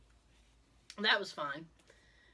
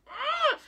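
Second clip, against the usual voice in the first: frustrated squeal; 5 dB louder than usual. Retold or imitated. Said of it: "I'm like".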